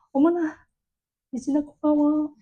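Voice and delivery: put-on a showy burst of gratitude to a quiet child; over-the-top praise. Shown as a demonstration. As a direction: lay the thanks on thick, like a thrilled mom. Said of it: "관심을 확 보여줘야 돼요. 과하게".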